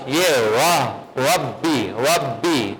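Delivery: "وبی" slow and repeated, first sound full; sound demonstration. Shown as a demonstration. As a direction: isolate "وبی" slowly so the vowel is heard. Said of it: "کون سی sound آ رہی ہے؟".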